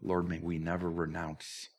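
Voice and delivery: reverent, slowed closing prayer. Acted. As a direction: pray it gently to close the service.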